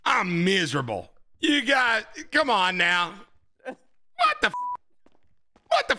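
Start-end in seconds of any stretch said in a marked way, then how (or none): 3.6-6.0 high, startled squeal; high-pitched shocked yelp at the screen; shocked cry. Real, not acted. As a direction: yelp it in disbelief.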